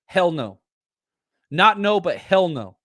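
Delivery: HELL punched firmly on each hell no; stress on HELL. Shown as a demonstration. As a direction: lean hard on hell, not no. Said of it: "what my mom always used to say".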